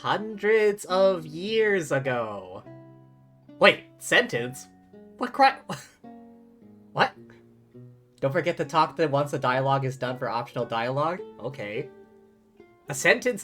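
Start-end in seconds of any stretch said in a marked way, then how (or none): none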